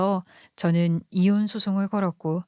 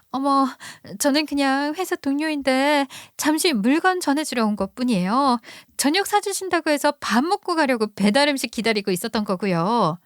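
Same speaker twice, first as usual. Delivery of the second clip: a lightly higher, sweetly innocent young woman playing dumb; coy mistress; 6 semitones higher than usual. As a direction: act the caught mistress feigning innocence. Mistress voiced in the retold fight. (시치미 떼는 상간녀 목소리)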